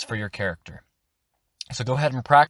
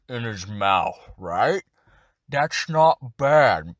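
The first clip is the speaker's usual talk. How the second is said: lightly lowered, slow orc voice, as if talking around tusks; orc voice demo; slower than usual. Shown as a demonstration. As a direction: talk slowly through big tusks, a hint of orc. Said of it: "So it's gonna sound a bit like this".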